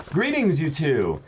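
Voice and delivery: bright read-aloud voice for the viewer's letter; reading a viewer's kind note to the camera. Acted. Reads fan letter aloud.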